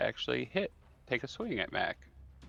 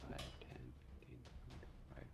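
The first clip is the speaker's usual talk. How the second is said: very quiet, halting count; counting under breath; 16 dB quieter, faster than usual. Real, not acted. Game master counting out a zombie's movement, under their breath. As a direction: count softly to yourself.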